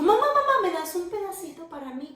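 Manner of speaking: put-on high, bright little-girl ask; sweet child's request. Susana politely asking mom for a piece, high child voice.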